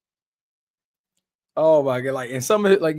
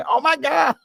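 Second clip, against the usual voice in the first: imitated yell; 11 semitones higher than usual. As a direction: mimic his high outcry. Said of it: "he's yelling out".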